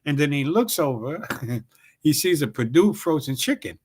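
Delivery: normal talking voice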